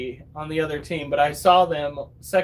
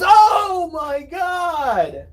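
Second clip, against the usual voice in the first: much higher, louder, drawn-out cry of alarm; shocked shout; 11 semitones higher, 5 dB louder, much slower than usual. Retold or imitated. Brother walking in on the bloody forehead, much higher slow shocked shout. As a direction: stretch out the horror. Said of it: "And he goes".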